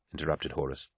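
plain reading voice

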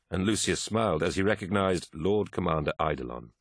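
ordinary delivery

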